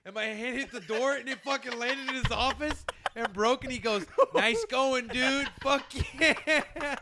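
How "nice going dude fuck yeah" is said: higher-pitched imitation of an annoyed boss; mock pissed-off sarcasm. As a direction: retell the boss's sore jab, pitched up. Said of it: "All pissed off".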